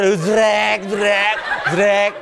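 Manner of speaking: mimicked packing tape rip, zrek; packing tape sound effect. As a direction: rasp out the tape noise. Imitating tape being pulled.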